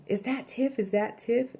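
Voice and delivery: high, frantic questioning; a retold panicked question, pitched high. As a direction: blurt it out in a panic. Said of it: "she's freaking out".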